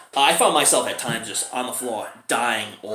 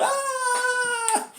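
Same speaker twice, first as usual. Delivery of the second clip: long, high imitation wail; mock scream. Victims sucked into the witch's world, mock scream.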